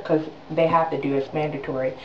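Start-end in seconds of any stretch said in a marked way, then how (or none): none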